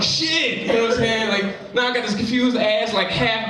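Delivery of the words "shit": shocked. Retold or imitated. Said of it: "And I'm like".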